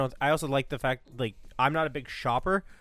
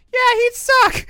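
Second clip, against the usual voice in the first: put-on very high, loud childlike taunt; bratty kid taunt; 13 dB louder, slower than usual. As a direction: shriek it like a bratty little kid. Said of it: "And I was like".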